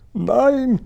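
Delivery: very high, stretched-out cry of no; alarmed cry. A narrator's reading. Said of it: "schrie Sophiechen".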